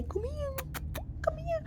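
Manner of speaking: high soft luring come here; a mock creepy stranger's coaxing call; creepy man. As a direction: lure a kid in with candy, high and hushed. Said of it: "big creepy man waiting there with candy".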